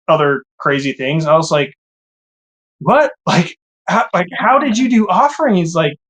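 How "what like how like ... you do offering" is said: high, baffled what and how; incredulous. Retold or imitated. In the car asking his wife, stunned high disbelief. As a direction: pitch the questions way up in disbelief.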